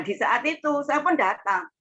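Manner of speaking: ordinary talk, nothing marked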